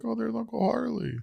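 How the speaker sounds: put-on high, soft child voice; lightly voiced toddler; imitating a little girl. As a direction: mimic the toddler spotting him. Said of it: "She's like".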